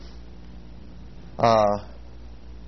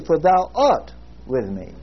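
"art" stretched and raised above the other words; stress on art; slower than usual. Shown as a demonstration. Preacher reading the verse another way, stress on "art". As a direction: slowly, hit "art", then drop on "with me". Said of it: "And this time you read it".

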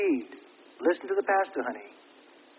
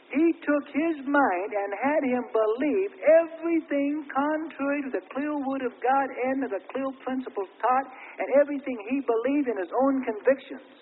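normal talking voice

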